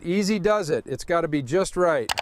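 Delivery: ordinary talk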